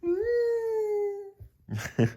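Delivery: tiny scared whimper; mock frightened whimper; high whimpering cry. Real, not acted. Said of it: "That's how I would be".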